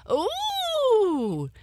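high startled squeal of a hare. A narrator's reading. Hare squealing as the paw grabs her. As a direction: squeal in a sudden fright. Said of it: "squealed the hare".

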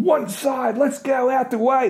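high, bossy, pushing through a crowd; pushy barging girlfriend. Retold or imitated. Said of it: "starts just going".

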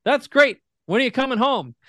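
a hammed-up, high dad voice; imitating dad. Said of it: "And he goes".

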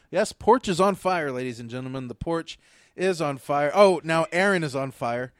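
loud, mock sports-announcer call of the fire; mock play-by-play. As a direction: call it like a live announcer, hamming it up. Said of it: "I was, uh, narrating your process".

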